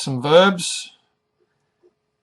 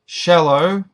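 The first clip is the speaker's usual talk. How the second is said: Shallow with SHAL said louder; stress on first syllable; 3 semitones higher, 5 dB louder than usual. Shown as a demonstration. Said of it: "the first syllable is emphasized".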